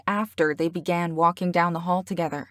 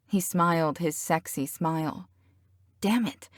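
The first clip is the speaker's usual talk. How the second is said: ordinary delivery, nothing marked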